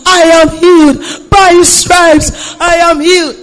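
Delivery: high, loud and slow; shouted declaration. Retold or imitated. She reenacts shouting her faith during labour pain, loud and slow. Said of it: "I was shouting the word".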